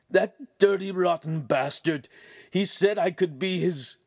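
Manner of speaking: high-pitched aggrieved outburst; bitter cursing. A narrator's reading. Ugolino fuming over the noble's betrayal, high bitter anger. Betrayed man curses in thought.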